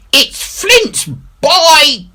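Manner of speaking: very high, loud panicked cry; panicked pirate shriek; terrified cry. A narrator's reading. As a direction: cry out in sheer terror. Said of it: "cried Merry".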